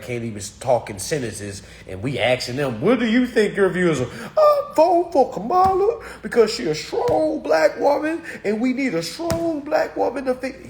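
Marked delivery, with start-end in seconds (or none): 2.8-10.7 high, loud put-on mocking voice; mock rapper voter. Streamer imitating rappers asked who they're voting for, high mocking voice. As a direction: squeal it out high and loud, hammed-up.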